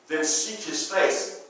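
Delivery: low, slow, soft counsel; hushed preaching. Retold or imitated. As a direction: draw it out gently and reverently.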